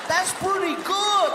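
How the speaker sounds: high, slow comedian impression; Paul Rodriguez. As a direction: draw it out high as the comic. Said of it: "I did that to Paul Rodriguez".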